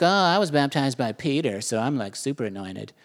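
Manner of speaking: mock a bragging church member; boastful Corinthians. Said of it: "the Corinthians are like".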